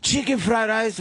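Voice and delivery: high, hammed-up mock Chinese accent. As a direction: announce each dish in a high put-on Chinese accent. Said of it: "in that stereotypical Chinese accent".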